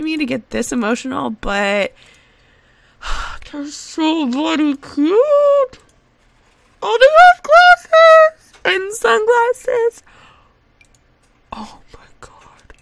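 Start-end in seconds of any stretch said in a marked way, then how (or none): 3.5-10.0 high, loud, drawn-out squeal; delighted squeal. Real, not acted. Gamer finding tiny glasses for toddlers, delighted squeal. 11.5-12.7 slow, very soft whisper; overcome whisper. Real, not acted. Gamer floored by toddler glasses, overcome whisper.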